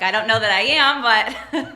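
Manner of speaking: laughing loudly through the words. Real, not acted. Guest laughing off the host calling her interesting, loud and laughing. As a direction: laugh it off, pleased and a bit shy.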